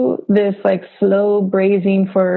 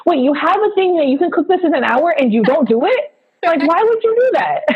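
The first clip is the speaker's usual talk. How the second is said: higher-pitched, incredulous Pidgin scolding; an ancestor's disbelief in lilting Pidgin, pitched up; Pidgin accent; 7 semitones higher than usual. Acted. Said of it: "That was great pigeon accent".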